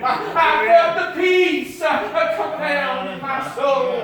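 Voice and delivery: loud, rising preacher's cadence, pushing each phrase; full-force preaching. Acted. Preacher exhorting the congregation about salvation, full-force preaching.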